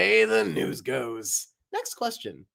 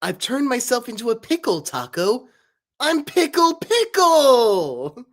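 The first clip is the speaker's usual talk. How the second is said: Pickle voice; 7 semitones higher, 6 dB louder than usual. Acted. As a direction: belt it like a goofy pickle. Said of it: "a little voice request here where I pretend that I'm pickle".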